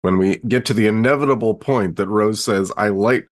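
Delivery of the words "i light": ordinary delivery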